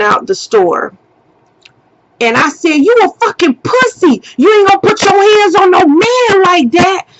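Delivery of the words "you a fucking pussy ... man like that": high, heated goading; angry taunt. Retold or imitated. Woman taunting the man as he leaves the store, angry. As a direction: hurl it after him in high-pitched scorn.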